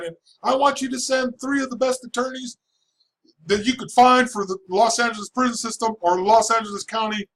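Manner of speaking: high, pushed judge voice; judge ordering. Retold or imitated. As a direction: demand it like the outraged judge. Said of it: "she said".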